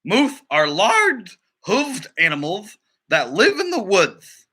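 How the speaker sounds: slow, prim director lecturing about moose; annoyed film director. Acted. The director telling the crew to remove the spacesuit, annoyed. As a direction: correct the crew with strained patience.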